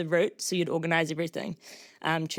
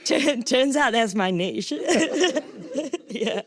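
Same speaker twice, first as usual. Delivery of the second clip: slow, louder and higher, through laughter; amused laughing; 6 semitones higher, 3 dB louder, slower than usual. Real, not acted. Runner joking about her farmer running mates, amused laughing. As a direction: joke at yourself, laughing as you talk.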